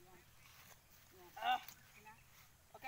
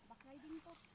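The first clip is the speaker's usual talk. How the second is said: ordinary talk, nothing marked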